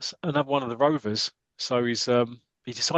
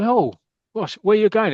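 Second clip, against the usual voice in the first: higher, louder startled cry; 8 semitones higher, 6 dB louder than usual. Acted. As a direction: jump at the sudden collision. Bumping into someone.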